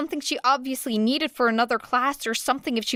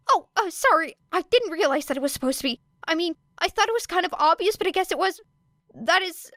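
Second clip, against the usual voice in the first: high, fast nervous babble; flustered rambling; 9 semitones higher, faster than usual. A narrator's reading. Midoriya apologizing awkwardly to Era, flustered fast babble. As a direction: trip over your own words.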